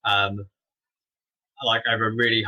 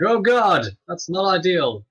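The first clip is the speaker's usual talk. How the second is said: high, loud mock panic; mock alarm; 8 semitones higher, 4 dB louder, slower than usual. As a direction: yelp it like a startled hero. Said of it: "they're like".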